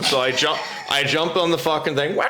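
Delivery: ordinary talk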